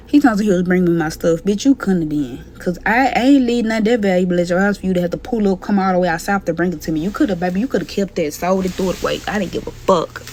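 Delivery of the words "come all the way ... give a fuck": ordinary talk, nothing marked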